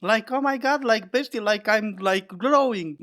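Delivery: high, breathy valley girl impression; mock bubbly bestie voice; man doing a woman's voice. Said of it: "You guys had enough with that voice?".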